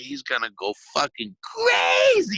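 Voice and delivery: slow, tearful yell, dragged out; crying shout. Retold or imitated. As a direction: wail it like he's about to break down. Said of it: "He says it just like that".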